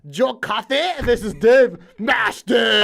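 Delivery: loud, drawn-out match announcement; hammed-up announcer shout. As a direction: belt out the names like a ring announcer.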